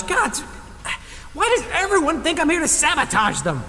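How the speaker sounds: high, exasperated complaint; exasperated whine. Acted. Cleaner fed up with everyone suspecting sabotage, high exasperated whine. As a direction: whine in frustration.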